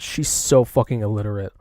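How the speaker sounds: ordinary talk, nothing marked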